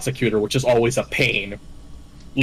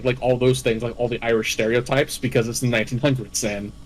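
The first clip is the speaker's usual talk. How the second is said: usual speaking voice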